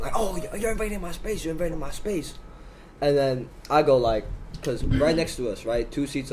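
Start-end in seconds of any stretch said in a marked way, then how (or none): 0.1-2.3 higher-pitched imitation of a complainer; mock a man whining in circles; complaining stranger. Stranger complaining to his train neighbour, higher-pitched mock whine. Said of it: "this guy who's complaining".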